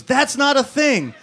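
high, loud, stretched-out wife's objection; slow, high-pitched yelled disbelief; exasperated mock yell. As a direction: imitate a wife shouting him down. Wife yells back in retold argument.

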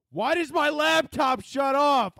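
high, slow exasperated yell; frustrated outburst; angry shout. Real, not acted. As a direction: bellow at the dead laptop. Laptop dies mid-recording.

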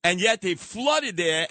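normal talking voice

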